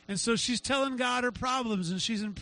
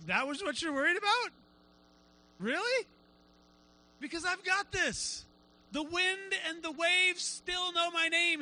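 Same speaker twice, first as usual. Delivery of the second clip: amused disbelief, almost laughing; 6 semitones higher than usual. Retold or imitated. God answering a worrier, amused and incredulous. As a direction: marvel at the worry, high and stretched. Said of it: "he's almost laughing like".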